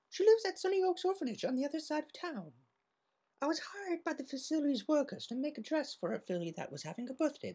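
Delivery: a polite lady explaining gently; Rarity voice. Acted. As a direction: elegant Rarity, soft and proper.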